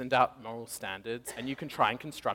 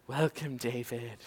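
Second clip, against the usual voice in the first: mystic psychic greeting; 5 dB quieter, slower than usual. Retold or imitated.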